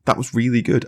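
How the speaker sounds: lightly hammed-up admission; conceding. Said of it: "went back to him and was like".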